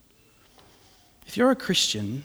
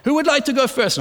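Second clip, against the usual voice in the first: mimicking the operator; 8 semitones higher, faster than usual. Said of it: "I'm like".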